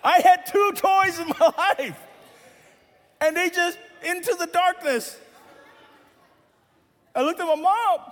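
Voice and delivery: hammed-up loss; mock despair. Real, not acted.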